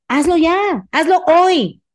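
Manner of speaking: slow emphatic command; urgent push. Real, not acted. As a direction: press them to act now. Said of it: "urging listener".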